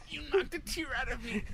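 high, strained mock-crying kid; imitated kid holding back tears; tearful child impression. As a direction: softly whimper it like a hurt little boy. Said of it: "He was like".

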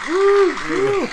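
high, stretched-out woo; excited whoop. Real, not acted.

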